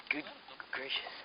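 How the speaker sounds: normal talking voice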